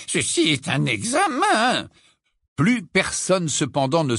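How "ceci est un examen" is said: slow, high, scandalized reproach; Professor Tufty. A narrator's reading. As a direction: protest slowly, pitch jumping with outrage. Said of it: "dit le professeur Tufty scandalisé".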